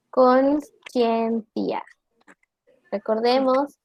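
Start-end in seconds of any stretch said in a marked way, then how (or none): 0.1-1.8 Conscientia very slow, ci said like Spanish ch; the Italian Latin reading, slow and clear; Italian Latin pronunciation. Shown as a demonstration. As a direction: spell out Conscientia syllable by syllable, ci as ch. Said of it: "se pronuncia como C H".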